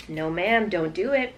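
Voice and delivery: ordinary talk, nothing marked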